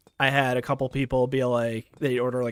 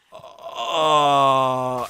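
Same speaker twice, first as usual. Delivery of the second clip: stunned; 9 dB louder than usual. Retold or imitated. Stunned reaction to creepy customer.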